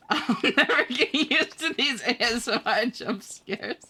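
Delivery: high, slow, laughing complaint; amused fluster. Real, not acted. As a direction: protest through giggles after a startle. Laughing after being startled.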